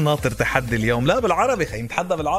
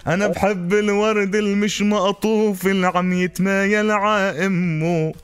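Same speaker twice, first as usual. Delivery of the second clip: slow stretched line ends; zajal recitation; 2 semitones higher, slower than usual. Acted. Zajal verse reply. (إلقاء زجل)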